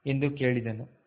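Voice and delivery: usual speaking voice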